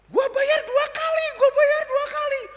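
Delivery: very high and loud pleading yell; shouting to the driver, pay double; desperate shout. Retold or imitated. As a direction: holler the offer after him. Yelling after fleeing driver.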